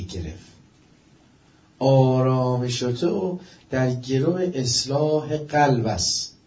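slow, loud, weighty address; solemn proclamation. Acted. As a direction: proclaim it slowly to all mankind. (خطابهٔ آهسته و باشکوه با صدای بلند)

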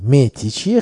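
normal talking voice

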